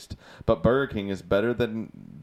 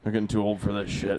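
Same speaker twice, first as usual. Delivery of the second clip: put-on British accent; faster than usual. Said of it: "That's a really good British accent".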